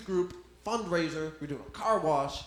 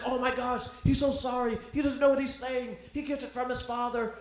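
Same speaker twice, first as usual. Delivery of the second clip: higher hurried mom voice; apologetic mom; 7 semitones higher than usual. Retold or imitated. The kid's mom apologizes to the comic in a higher flustered voice. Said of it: "She's like".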